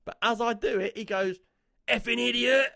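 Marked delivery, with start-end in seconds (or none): 1.9-2.7 high, louder imitation of an angry cyclist; retold angry yell. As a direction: throw the insult out in a mock yell. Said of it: "straight to my face".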